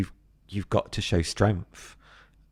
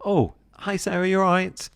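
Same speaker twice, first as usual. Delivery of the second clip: much higher, sing-song "you all right?"; chirpy British greeting; 11 semitones higher than usual. Retold or imitated. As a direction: greet a colleague brightly in passing. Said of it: "as British people, we say".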